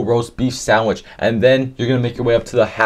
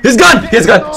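panicked shout; 6 dB louder than usual. Pretend. Cop shouting suspect escaped.

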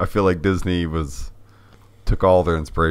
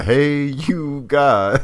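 drawn-out mock yell, pitched way up; Sloth's scream; 9 semitones higher, much slower than usual. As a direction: stretch it into a high, slow imitation holler. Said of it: "him screaming that".

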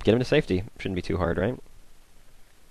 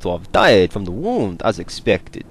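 slow, hammed-up attempt at a French accent; botched French accent; 4 dB louder, slower than usual. Player reading quest text aloud, botched French accent. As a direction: read it out in a badly faked French accent. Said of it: "I don't know what accent that was, but it wasn't French".

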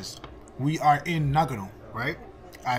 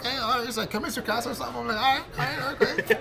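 high, bemused self-quote; playing the baffled student; overwhelmed; 8 semitones higher than usual.

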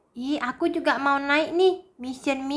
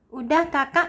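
ordinary delivery

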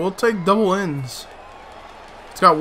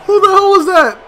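high, loud indignant yell; outraged shout; 12 semitones higher, 6 dB louder than usual. Real, not acted. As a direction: demand to know, loud and high. Outraged at the play.

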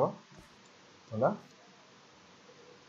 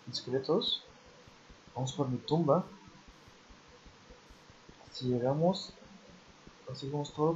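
hushed, soft voice to stay unheard; whispering while hiding; 10 dB quieter than usual. Real, not acted. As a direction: keep it down so the tigers won't hear. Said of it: "No sé por qué hablo así, para que los tigres no nos oigan".

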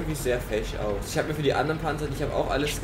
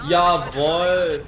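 triumphant shout; 5 semitones higher, 9 dB louder than usual. Real, not acted. Celebrating a hit.

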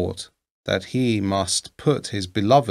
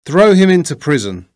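loud, furious royal order, pitched higher; angry cry; 6 semitones higher, 8 dB louder than usual. A narrator's reading. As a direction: bellow the command in sudden rage. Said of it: "grew very angry and cried out".

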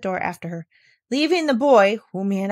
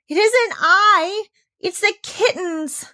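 put-on slow, much higher child voice; little girl pleading; 13 semitones higher, 6 dB louder, slower than usual. Girl explaining to the governess, high and pleading.

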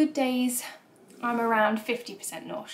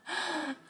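gasp; 7 semitones higher, 5 dB quieter than usual. Real, not acted. Seeing the baby on scan.

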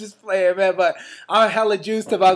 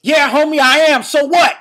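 loud and higher, challenging; defiant shout; 6 semitones higher, 9 dB louder than usual. Retold or imitated. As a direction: snap back ready to fight. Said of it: "And then I was like".

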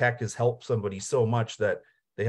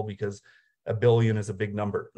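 ordinary talk

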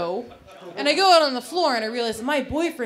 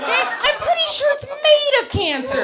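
outraged shout; 9 semitones higher, 5 dB louder than usual. Real, not acted. Outraged punchline rant.

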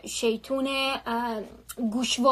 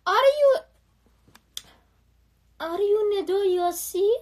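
high, slow put-on English accent; fake English accent; 5 semitones higher, much slower than usual. A boy faking an English accent, asking her name. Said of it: "خودشو مثلاً انگلیسی کرده".

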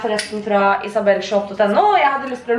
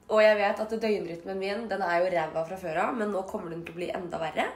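much softer, hushed talk at night; late-night hush; 10 dB quieter than usual. Real, not acted. Isolated vlogger on the toilet at night, hushed. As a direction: keep it down, it's the middle of the night.

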